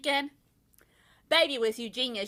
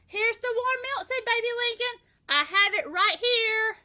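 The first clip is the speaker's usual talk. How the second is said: high, louder chirpy voice; eager; 11 semitones higher, 5 dB louder than usual. A narrator's reading. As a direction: bustle in brightly. Sister brings milk eagerly.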